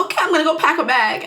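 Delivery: quick, high, eager acting; happy excitement. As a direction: rush it out in imitated delight. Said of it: "she's so happy".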